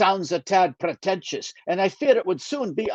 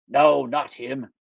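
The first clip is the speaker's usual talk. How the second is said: usual speaking voice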